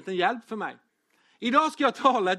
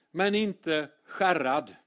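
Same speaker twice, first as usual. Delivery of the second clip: slow title; much slower than usual. Shown as a demonstration. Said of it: "title spoken slowly".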